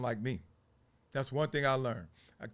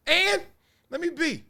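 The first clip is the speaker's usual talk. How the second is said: very high-pitched mock comeback; acted defiant retort; 14 semitones higher, 5 dB louder than usual. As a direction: throw the retort back way up high. Acted retort to those labelling kids.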